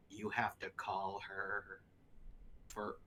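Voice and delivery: low, slow, hushed animators; animators quoted. Retold or imitated. Animators coaxing Steve to call her, low and hushed. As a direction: mutter it like nerds hatching a plan. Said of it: "and they go".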